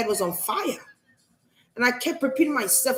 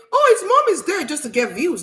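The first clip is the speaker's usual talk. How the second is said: higher-pitched mocking quote; mock speculation; 7 semitones higher, 4 dB louder than usual. Mimicking someone who speculates for views, mocking. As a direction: mimic a gossip in a raised voice. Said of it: "didn't just speculate and say".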